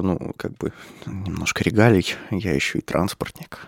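low, breathy sultry voice; like a phone-sex line. Real, not acted. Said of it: "Что это за томный голос?".

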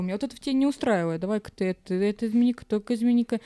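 ordinary talk

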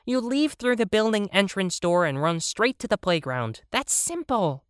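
high bunny voice; whiny bunny. Acted. Bunny argues the route is easy, in a high whiny voice. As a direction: keep the bunny high, highest at the end.

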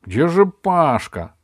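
high, slow, loud anxious question; worried. Acted. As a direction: fret over the missing friend. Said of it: "волновалась она".

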